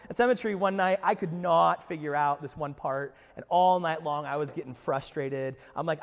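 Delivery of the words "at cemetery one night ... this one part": ordinary delivery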